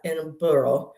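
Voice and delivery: Edinburgh ending in a "burl" sound; mispronounced Edinburgh. Retold or imitated. Retelling the second wrong version, Edinburgh ending like "burl". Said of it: "It's not Edinburgh".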